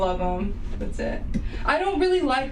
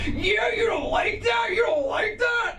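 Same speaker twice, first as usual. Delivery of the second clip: high mocking road rage shout, slow; dad yelling; 12 semitones higher, slower than usual. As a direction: yell it like an angry dad in traffic.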